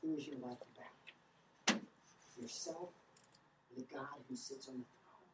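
quiet probing question. Real, not acted. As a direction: ask it gently, almost to yourself. Preacher asks congregation quietly.

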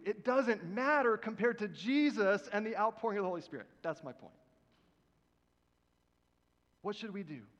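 usual speaking voice

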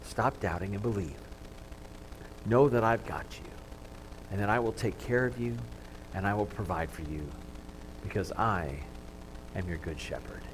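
quiet. Acted. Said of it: "wants to say to each".

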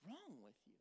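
hushed, incredulous scolding; exasperated God. Acted. God asking Elijah what is wrong, hushed exasperation. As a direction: scold softly, baffled by his behavior. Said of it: "I think that's God being like".

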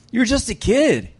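high-pitched, disbelieving put-down; incredulous dismissal. Acted. Saul doubting young David before the fight, incredulous. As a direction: pitch it way up, scoffing at the boy.

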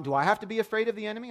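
lightly voiced worried child; a hint of an anxious five-year-old; child's question. Son asks, voiced higher.

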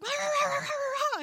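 high-pitched garbled mock yell; imitated excited yelling. Dad yelling down the phone, garbled mock yell. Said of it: "he's yelling, he's like".

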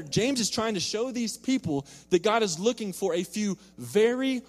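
loud, high, impassioned preaching; fervent preacher's build to the point; preaching at full force. Acted. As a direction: drive it home from the pulpit, slowing on the key words. Preaching the sermon's climax.

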